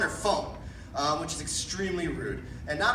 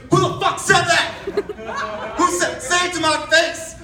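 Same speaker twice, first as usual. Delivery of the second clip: higher, louder furious shout; angry yell; 10 semitones higher, 8 dB louder than usual. Retold or imitated. The angry man at the back shouting at the crowd, furious. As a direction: bellow it like a man picking a fight.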